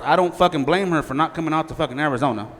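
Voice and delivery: usual speaking voice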